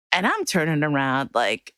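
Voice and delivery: ordinary talk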